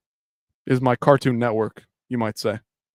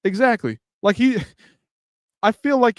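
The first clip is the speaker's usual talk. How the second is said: excited agreement; 8 semitones higher, 4 dB louder than usual. Real, not acted. Excited agreement about Bryce.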